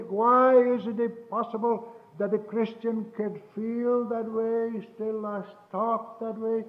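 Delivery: loud, slow, high pulpit thunder; full-force preaching. Acted. Preacher booms to his congregation, loud and slow. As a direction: hammer each question home to the congregation.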